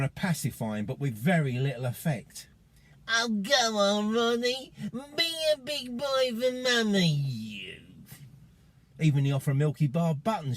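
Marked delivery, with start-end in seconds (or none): 3.1-7.8 high, slow Essex drawl. Retold or imitated. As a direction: coax like an Essex mum, Essex accent. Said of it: "in her slow Essex drawl".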